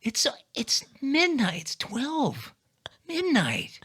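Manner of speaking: high, slow, appalled dad; dad impression. Son plays his dad appalled at the hour, high slow voice. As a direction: stretch it high in disbelief. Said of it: "he looks at me and goes".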